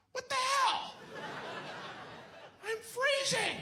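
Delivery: very high squeaky cold sheep; cartoon sheep complaining of cold; shorn sheep. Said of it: "the sheep are like".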